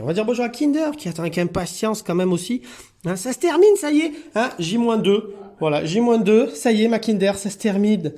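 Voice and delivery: chirpy coo to the departing cat; high pet talk. Real, not acted.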